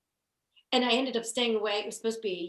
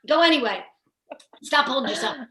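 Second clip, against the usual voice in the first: loud, raised mock orders; obsessed grandma; 5 semitones higher, 10 dB louder than usual. Grandma ordering the child to the bathroom, mock-scolding. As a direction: imitate a bossy grandmother giving commands. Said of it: "My grandma was always obsessed".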